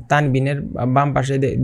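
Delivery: ordinary talk, nothing marked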